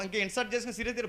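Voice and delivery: ordinary talk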